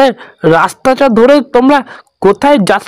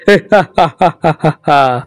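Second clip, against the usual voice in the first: ghostly laugh; 4 semitones lower than usual. Acted. Ghost laughs at frightened old man.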